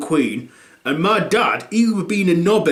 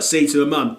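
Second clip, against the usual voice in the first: ordinary talk, nothing marked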